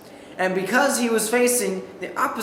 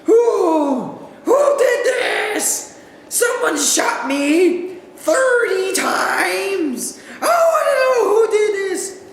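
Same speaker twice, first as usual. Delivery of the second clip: high, loud, slow furious shout; furious screaming; 11 semitones higher, 3 dB louder, much slower than usual. Retold or imitated. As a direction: roar for the culprit.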